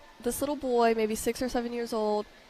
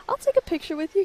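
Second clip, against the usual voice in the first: put-on higher, bright reassurance for a child; sweet to a kid; 8 semitones higher, faster than usual. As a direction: say it kindly to a starstruck boy. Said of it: "I was like".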